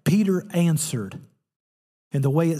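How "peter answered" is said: usual speaking voice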